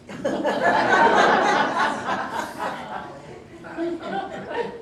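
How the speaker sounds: big high delighted laugh; high, long, delighted laugh. Real, not acted. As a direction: burst out laughing at the punchline. Laughing at license plate story.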